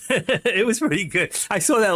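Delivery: chuckling at his own punchline; laughing. Real, not acted. Pleased with his joke, says it through a laugh.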